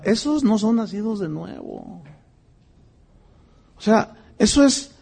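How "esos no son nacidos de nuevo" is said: ordinary talk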